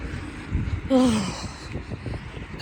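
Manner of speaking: long, breathy puffed-out exhale; heavy sigh. Real, not acted. Walker worrying about weight loss, heavy sigh. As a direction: let out a long, weary sigh. Worried sigh while out walking.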